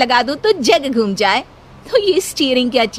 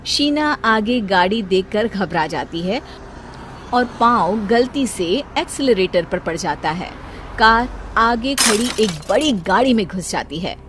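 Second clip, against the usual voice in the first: story narration; 4 semitones lower than usual. A narrator's reading. Narrates the crash.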